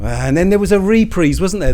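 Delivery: normal talking voice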